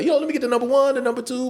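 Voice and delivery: high, sing-out ordering voice; mock food order. Shown as a demonstration. Big eater at the counter, high mock ordering.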